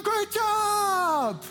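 high, loud cheering voice; over-praise a kid who got it wrong; gushing praise. Retold or imitated. People praising a kid who did wrong, high and gushing. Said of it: "people are going".

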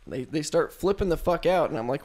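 ordinary talk, nothing marked

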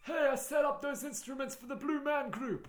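high, laughing, indignant complaint; mock commentator. Ending the riff, high, laughing and indignant. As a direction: fuss over the instruments with a laugh. Friends trading commentator impressions.

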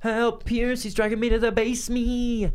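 high, loud fake panic; mock panic. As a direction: cry for help hammily. Dragged by ghost.

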